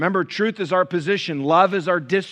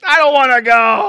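high, loud, rising whine; childish whine; 10 semitones higher, 12 dB louder than usual. Retold or imitated. Churchgoer resisting accountability group, high loud childish whine. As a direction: stamp your foot like a sulky kid. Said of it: "how most of us feel".

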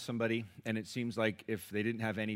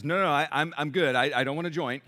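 louder, higher, hurried no-thanks; flustered refusal; 4 semitones higher, 7 dB louder than usual. Retold or imitated. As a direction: wave off the offer in a flustered rush. Bob refusing a joint, retold.

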